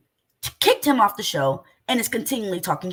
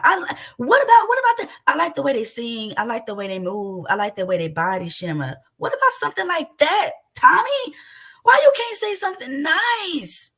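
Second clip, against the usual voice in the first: heated yell; 2 semitones higher, 4 dB louder than usual. Real, not acted. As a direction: confront him hard and loud. Heated confrontation with Tommy.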